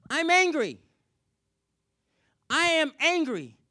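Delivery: angry outburst. Retold or imitated. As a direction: let the anger burst out loud. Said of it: "And she says".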